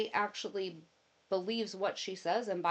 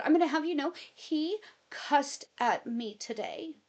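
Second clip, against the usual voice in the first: indignant tattling; 8 semitones higher, 4 dB louder than usual. Retold or imitated. Wife tattling to her mom about husband's cussing, mock outrage. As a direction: play the outraged victim.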